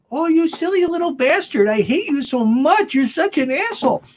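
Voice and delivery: high, cooing voice saying nasty words; sweet-talking insults. Retold or imitated. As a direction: croon the insults like praise. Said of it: "It's all in the tone of your voice".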